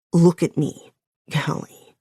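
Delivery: a hint of a firm man calling her name; lightly voiced man, firm then trailing; male voice. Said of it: "coming from his voice now".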